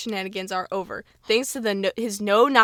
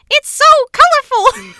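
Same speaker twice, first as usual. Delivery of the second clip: Miss Denver voice; 12 dB louder than usual. Acted. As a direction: squeal it like a delighted teacher. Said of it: "That's what Miss Denver sounds like".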